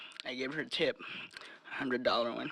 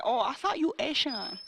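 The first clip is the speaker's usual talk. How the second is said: mock accent lady; 9 semitones higher than usual. Lady's retorted quote.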